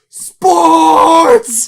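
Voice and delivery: long high scream, drawn out; full-voiced, high, stretched-out yell in imitation; mock scream. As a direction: scream it like a heckler in the crowd. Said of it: "who screamed".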